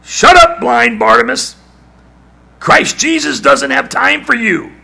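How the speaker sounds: scornful high loud shout; crowd shouting. Acted. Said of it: "told him to shut up".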